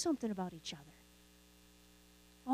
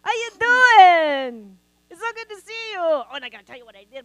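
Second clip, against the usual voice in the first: high, loud greeting that drawls, then rattles off fast; close-talker impression; 6 semitones higher, 13 dB louder than usual. Pastor acting a close talker gushing to a friend, high and loud. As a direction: gush at someone right in their face. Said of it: "they talk like this".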